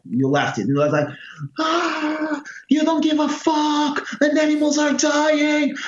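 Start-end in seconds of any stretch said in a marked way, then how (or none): 1.6-5.8 high, strained cry of despair at his father; retold outburst. Son yelling at father over animal testing, high and desperate. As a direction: pitch jumps way up as he loses it. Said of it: "completely lost it".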